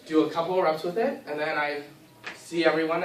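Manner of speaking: usual speaking voice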